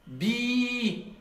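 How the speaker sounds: drawn-out long "Bi" with a held ee. Shown as a demonstration. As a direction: stretch "Bi" into a long vowel. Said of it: "je sais déjà".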